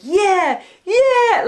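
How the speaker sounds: high, loud gleeful yeah, yeah; gleefully cheering at a death scene; excited cheer. Retold or imitated. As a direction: cheer like a horror fan at the gory part. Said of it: "I was like".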